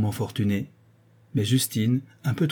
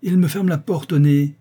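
angry complaint; 5 semitones higher, 5 dB louder than usual. A narrator's reading. Marquis angry behind closed door.

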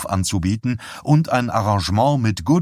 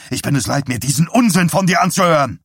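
loud, higher fed-up rant; angry outburst; 8 semitones higher, 5 dB louder, faster than usual. A narrator's reading. Husband fed up with his wife's preaching, angry outburst. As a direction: let the exasperation build into shouting.

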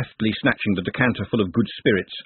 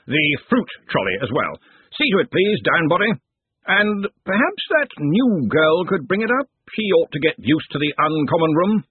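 put-on higher booming old wizard voice; hearty Arch-Chancellor; 6 semitones higher than usual. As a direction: order food like a jovial host.

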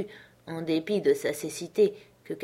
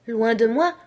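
breathless question; 4 semitones higher, 6 dB louder than usual. A narrator's reading. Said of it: "demandai-je allaitante".

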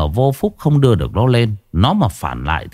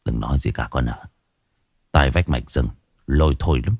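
much lower and softer, hushed; 9 semitones lower, 5 dB quieter than usual. Acted. Old man hushing his daughter-in-law, low and soft. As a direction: drop the voice so no one overhears. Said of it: "thấp giọng".

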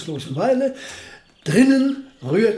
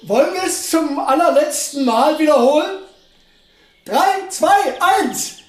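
much higher, louder, bubbling over; eager excitement; 9 semitones higher, 4 dB louder than usual. Acted. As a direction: rush into the last countdown. Eager to repeat experiment once more. (eifrig und aufgeregt, viel höher)

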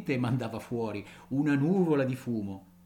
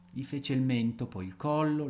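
plain reading voice

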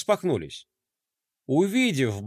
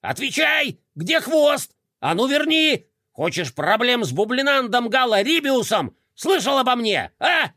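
high frantic bullying yell; bully the box for answers; frantic shouting hostage taker; 5 semitones higher than usual. A narrator's reading. Bublik threatens the box.

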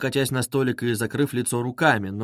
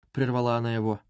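usual speaking voice

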